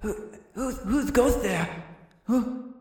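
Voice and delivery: high, quavering who-goes-there; slow, wary call into the dark; frightened captive. Acted. Game master retrying the prisoner's voice, fearful. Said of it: "I can come up with a better voice".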